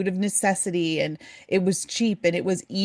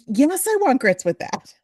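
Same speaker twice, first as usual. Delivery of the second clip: eager mock reply; 6 semitones higher, 6 dB louder than usual. Acts out eager young self answering waitress.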